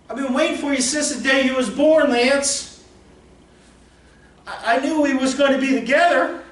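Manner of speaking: high, loud, pleading call of a dream; dream calling Lance. Acted. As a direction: call him back like a lover who's been waiting.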